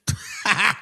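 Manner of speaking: short scoffing laugh; brief derisive laugh. Real, not acted. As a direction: laugh after mocking someone. Laughs at his own mockery.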